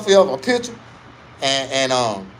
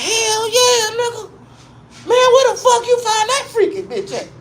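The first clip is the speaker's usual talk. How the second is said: put-on high wheezy old man; 4 dB louder than usual. Exhausted OG answers.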